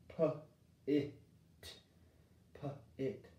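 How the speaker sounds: "P-I-T" stretched slowly, letter by letter; sounding out. Shown as a demonstration. Teacher trying the short I, slow sounding out. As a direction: pull the sounds apart before blending. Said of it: "Let me try my short I".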